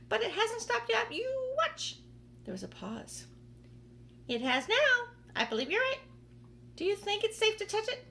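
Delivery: high, loud squabbling voices; bickering aunts. Acted. Two aunts arguing whether the peach stopped, high loud squabble.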